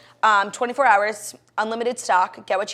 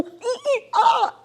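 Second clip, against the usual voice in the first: high playful ape calls; monkey noises; 5 dB louder, much slower than usual. Pretend. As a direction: squeal like a monkey for a laugh. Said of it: "I feel like a gorilla".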